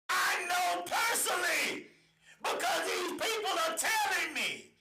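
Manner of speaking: real outraged insistence, pitched high; impassioned shouting. Real, not acted. Confronting officials with reports.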